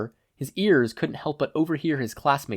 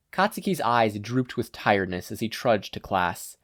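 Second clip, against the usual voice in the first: plain reading voice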